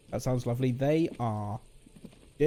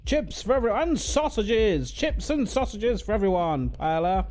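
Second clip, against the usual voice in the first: grand proclamation; 7 semitones higher than usual. Pretend. Proclaiming chips for all.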